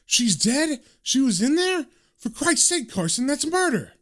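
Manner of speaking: high-pitched, appalled disbelief; shocked. A narrator's reading. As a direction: react in horror, voice jumping right up. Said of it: "this is a shock to Quincy".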